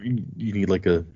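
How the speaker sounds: ordinary talk, nothing marked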